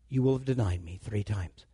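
hushed, unvoiced whisper of a prophecy. Jesus whispering to Peter, a hushed warning. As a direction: breathe it out as a secret.

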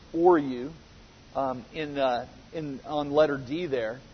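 usual speaking voice

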